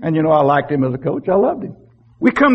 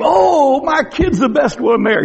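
high, shouted proud parent at the ballgame; bragging parent; 7 semitones higher than usual. Retold or imitated. Parent bragging at a Little League game, high shout. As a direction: crow about your kid from the bleachers. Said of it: "We brag on our kid".

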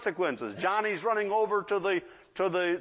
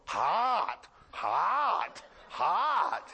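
drawn-out mock toddler warning; toddler warning everyone; much slower than usual. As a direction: play a little kid solemnly teaching, each word stretched. Imitating burnt toddlers warning everyone.

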